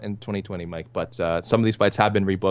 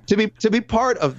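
higher, catching voice; choked up; 12 semitones higher than usual. Real, not acted. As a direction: push on through a catch in the throat. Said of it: "I'm getting choked up here".

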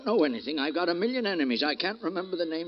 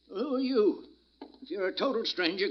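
ordinary talk, nothing marked

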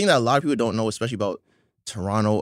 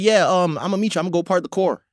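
higher-pitched mock L.A. accent, "car" turned to "core"; teasing impression of an L.A. accent; L.A. accent, A's as O's; 6 semitones higher than usual. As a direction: imitate an L.A. accent with rounded A's. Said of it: "your A's are O's".

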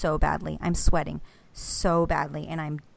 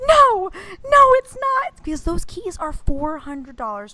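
much higher and louder, denying it; desperate wail; 13 semitones higher, 5 dB louder than usual. Retold or imitated. Wife refusing to accept the dead key, high wail. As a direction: cry out in denial. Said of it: "and I'm like".

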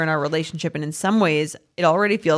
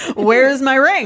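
higher, half-laughing mock demand; exasperated but joking; 7 semitones higher, slower than usual. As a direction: playfully confront a partner, voice pitched up. Acted confronting of fiancé, laughing.

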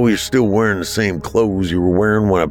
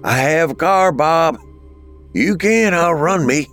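drawn-out, raised-pitch call; 10 semitones higher than usual. A narrator's reading. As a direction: call across a distance to a fleeing brother. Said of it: "Marco called out, running behind him".

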